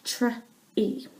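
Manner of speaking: Tr-e split as a blend then vowel; sounding out words. Shown as a demonstration. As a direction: sound out Tr-e slowly. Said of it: "which that would be tree".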